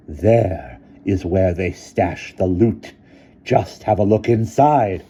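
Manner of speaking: put-on slow loud old woman; accusing headmistress. A narrator's reading. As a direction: point out the loot. Headmistress pointing to the loot.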